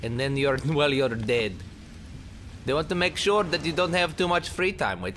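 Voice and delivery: usual speaking voice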